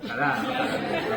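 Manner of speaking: slow, laughing "Jalada"; laughing mock threat. Teacher jokingly threatens to fail a cuy-hater, laughing. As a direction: joke about failing them, chuckling.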